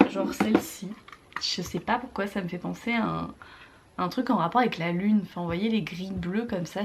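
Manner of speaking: ordinary talk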